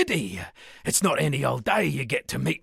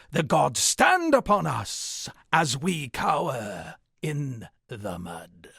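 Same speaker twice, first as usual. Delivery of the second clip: slow, high, dramatic declaiming; poem recital; 5 semitones higher, slower than usual. Acted. Bard recites his new poem to the exile, slow and grand. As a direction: intone each line like a doom-laden ballad. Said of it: "I've written a little something".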